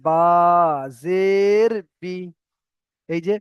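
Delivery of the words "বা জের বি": বা and জের held long, বি kept short; stretched spelling recitation. Shown as a demonstration. Teacher shows learners where to stretch, measured chant. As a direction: draw out the names, clip বি. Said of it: "আমি একটু টান দিলাম জের বলে".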